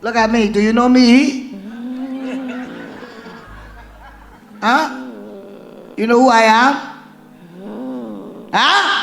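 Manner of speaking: loud, forceful preaching, often high; shouted deliverance commands. Acted. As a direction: bark commands at the demon at full force. Shouting questions at the woman.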